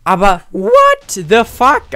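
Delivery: high, loud, drawn-out disbelief; shocked what the fuck. Real, not acted. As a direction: stretch it out in shock. Shocked at destroyed base. (ungläubig gedehnt)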